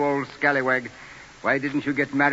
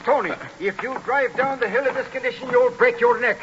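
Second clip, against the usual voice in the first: alarmed warning; 9 semitones higher than usual. Acted. Priest warns drunk Tony.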